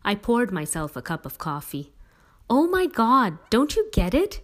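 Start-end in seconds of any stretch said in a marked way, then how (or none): 2.5-4.4 exasperated shriek, higher and louder. A narrator's reading. Sister shrieking at her clueless brother, exasperated. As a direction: burst out in disbelief. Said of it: "she shrieked at me".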